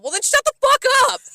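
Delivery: high, loud, fast mock-quarrel outburst; exasperated yell. To boyfriend talking to himself, exasperated mock yell. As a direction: burst out at him in comic exasperation.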